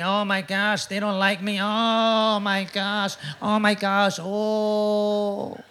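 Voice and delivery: soft, strained, fretting voice; anxious whimper. Retold or imitated. Pastor mocking his own worry over critics, soft fretful whine. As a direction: fret like a pastor who thinks nobody likes him. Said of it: "I don't even know why I'm talking like that".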